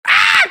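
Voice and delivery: high frustrated scream; exasperated yelp; frustrated shriek. Real, not acted. Yelling at the speech.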